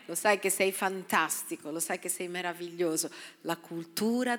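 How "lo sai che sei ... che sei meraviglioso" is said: softly spoken affirmation; tender encouragement. Shown as a demonstration. Pastor modelling encouraging words, soft and tender. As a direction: speak it gently like to a child.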